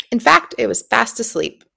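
ordinary delivery

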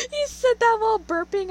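high, loud laughing. Real, not acted. Bursts out laughing at burp.

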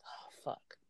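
under-breath whispered oh fuck; a whispered curse to herself. Retold or imitated. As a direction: breathe it out unvoiced, barely audible. Muttered curse at the counter.

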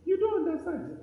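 high wailed objection, mock mourner; acted grieving protest. Mourner rejecting the comforter, high acted protest. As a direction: push back in a high, pained pretend voice.

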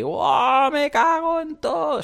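mock mountain scream. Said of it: "salir y gritar en plena montaña".

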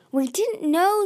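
high, urgent protest. A narrator's reading. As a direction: blurt it out defensively. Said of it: "Tsunami protested".

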